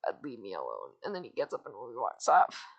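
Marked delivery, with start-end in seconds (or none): 0.1-0.9 very low, soft brush-off; loner cat. Retold or imitated. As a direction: sink low and quiet to push them away. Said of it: "then he's like".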